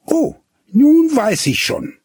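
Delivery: put-on high, loud girl's exclamation; eager cry. A narrator's reading. Gretlieschen calls out, having guessed his secret. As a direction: burst out, she's guessed it. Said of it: "rief Gretlieschen".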